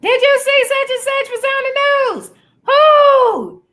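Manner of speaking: high, loud shouting across the house; yelling room to room. Retold or imitated. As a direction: call it out to someone in another room. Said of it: "conversations from room to room".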